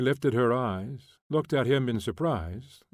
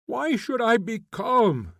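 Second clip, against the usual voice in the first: much higher and louder, flushed with anger; heated outburst; 11 semitones higher, 5 dB louder than usual. A narrator's reading. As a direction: flare up at being told to calm down. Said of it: "she began quickly".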